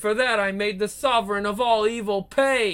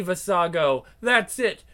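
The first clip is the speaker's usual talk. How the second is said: normal talking voice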